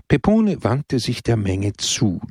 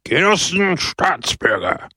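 high, declaimed orator's call; shouted address; 6 semitones higher than usual. A narrator's reading. Pepone from the town hall balcony, shouting oratory. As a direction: hail the crowd from a balcony. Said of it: "schrie Pepone".

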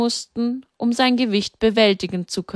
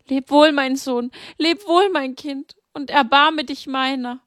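higher, tender grieving goodbye; tearful farewell; 6 semitones higher than usual. A narrator's reading. Mary bids her dead son farewell, tearful. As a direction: kiss him farewell through tears. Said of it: "begannen ihre Tränen erneut zu fließen".

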